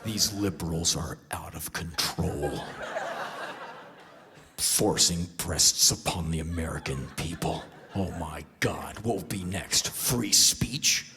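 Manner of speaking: deep mock outrage of a cable host; Sean Hannity. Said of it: "Sean Hannity comes back like".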